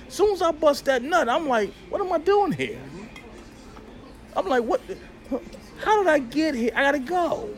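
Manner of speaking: high, rushed, bewildered self; mock panic. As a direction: play the man suddenly wondering why he's here. Acts his post-sex panic.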